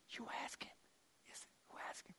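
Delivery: hushed secret whisper. Acted. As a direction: whisper it so Jesus won't hear. Disciples whispering at the table.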